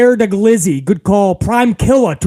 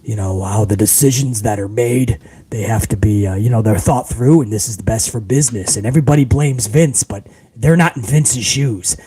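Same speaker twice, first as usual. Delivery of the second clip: much lower, put-on graspy executive voice; fake deep Triple H; 9 semitones lower than usual. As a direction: drop into a heavy corporate boss voice like Triple H on the mic. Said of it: "he talks like this".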